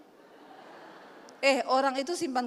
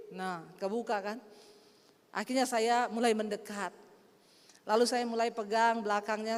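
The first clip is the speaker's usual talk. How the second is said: ordinary talk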